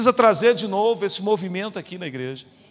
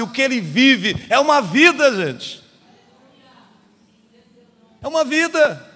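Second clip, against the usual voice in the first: loud, higher-pitched sermon delivery; impassioned preaching; 6 semitones higher, 7 dB louder than usual. Real, not acted. Pastor quotes Spurgeon to the congregation, preaching forcefully. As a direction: drive each phrase home at full force.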